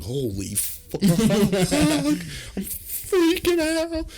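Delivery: very high, drawn-out freak-out squeal; comic high-pitched panic; mock panic. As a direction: stretch it into a strained falsetto. Acts stoned panic at the show.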